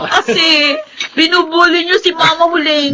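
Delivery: high, half-laughing protest; laughing complaint. Acted. Daughter laughing at family teasing her mother. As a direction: whine through laughter.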